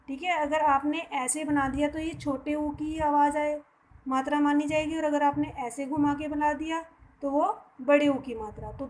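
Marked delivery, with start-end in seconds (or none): none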